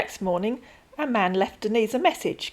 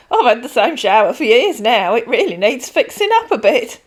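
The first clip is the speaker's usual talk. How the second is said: put-on chatty elderly lady, louder than the narration; a kindly pensioner rambling on; old lady Denise; 3 semitones higher, 9 dB louder than usual. Voices Denise.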